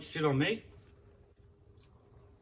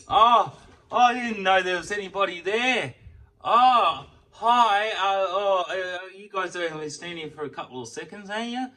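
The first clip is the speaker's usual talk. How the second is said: dazed, dragged-out voice, pitched higher and louder; stoned attendant; 5 semitones higher, 7 dB louder, slower than usual. Retold or imitated. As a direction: play a spaced-out guy who just woke up. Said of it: "He looks like he's fucking stoned off his ass".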